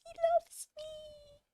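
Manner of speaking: high swooning "he loves me"; delighted squeal. Retold or imitated. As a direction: coo over the bird. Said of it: "And I was like".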